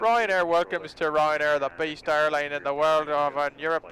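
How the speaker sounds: slow Irish airline announcement; mock Irish accent. Welcoming passengers to Ryanair, mock Irish accent. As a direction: ham up an Irish cabin welcome. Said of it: "I'll do everything for in, in an Irish accent".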